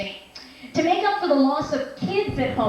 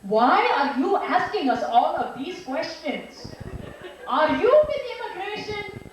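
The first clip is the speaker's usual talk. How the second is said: suspicious mother; 4 semitones higher than usual. Acted. Mother questioning a therapist in session, suspicious. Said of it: "My mother grew very suspicious".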